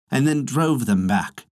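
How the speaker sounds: ordinary delivery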